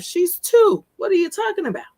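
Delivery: can't believe what you're hearing; incredulous outrage. Real, not acted. Reacting to the officer's excuse about a two-year-old, high incredulous outrage.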